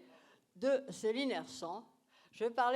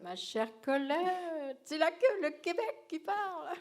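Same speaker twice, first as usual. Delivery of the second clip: Québécois accent; 5 semitones higher than usual. Real, not acted. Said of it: "je peux pas trahir mon accent".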